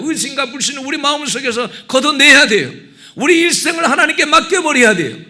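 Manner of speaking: high, loud exhortation; impassioned preaching. Real, not acted. Pastor urges congregation to entrust their lives, impassioned preaching.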